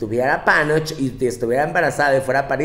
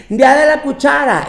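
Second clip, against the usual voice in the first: mock excitement; 10 semitones higher, 7 dB louder than usual. Mocking people who celebrate everything.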